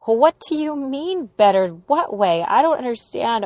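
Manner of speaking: frantic shouting. Retold or imitated. Arguing through the locked door.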